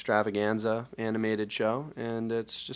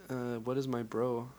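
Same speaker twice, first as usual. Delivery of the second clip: ordinary delivery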